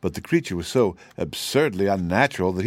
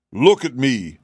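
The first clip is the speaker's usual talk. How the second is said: a proud, louder call of look at me; crying out; 4 semitones higher, 6 dB louder, slower than usual. Acted. Cab horse showing off to the sawhorse, crying out proudly. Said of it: "he cried".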